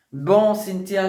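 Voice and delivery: normal talking voice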